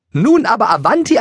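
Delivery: very high, loud, rising call to hurry; hurried Maria. A narrator's reading. Maria hurries everyone along.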